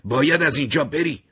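high, loud, urgent cry; urgent shout. A narrator's reading. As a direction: burst in and shout the warning. Caroline bursts in warning Terry.

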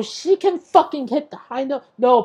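usual speaking voice

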